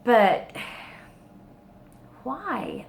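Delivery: genuinely mystified, rising way up; baffled plea. Real, not acted. Puzzled by female narrator.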